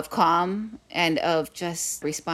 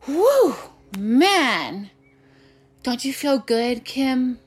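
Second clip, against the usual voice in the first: high, hammed-up sigh of relief; dramatic relief; 7 semitones higher, 4 dB louder, much slower than usual. Dad teasing his daughter after the cliff drop, hammed-up relief. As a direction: play up the relief like a joke. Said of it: "really dramatically".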